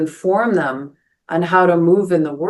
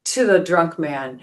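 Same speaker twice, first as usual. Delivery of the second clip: usual speaking voice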